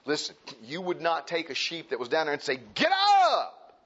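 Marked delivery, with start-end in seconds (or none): 2.6-3.8 sudden high, loud yell; harsh yelled command. Retold or imitated. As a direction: bark the order down at the fallen sheep. Yelling at downed sheep.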